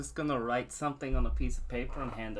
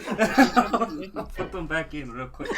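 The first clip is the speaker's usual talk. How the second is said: laughing; 6 semitones higher, 9 dB louder than usual. Real, not acted. Caught showing bat wings.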